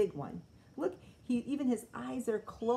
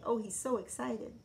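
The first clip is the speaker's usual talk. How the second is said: ordinary delivery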